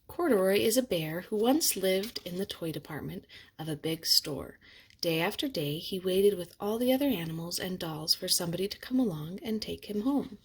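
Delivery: usual speaking voice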